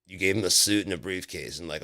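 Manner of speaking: usual speaking voice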